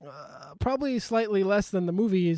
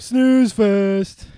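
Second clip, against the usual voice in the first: loud mocking; 4 semitones higher, 12 dB louder than usual. Real, not acted. Mocking the documentary.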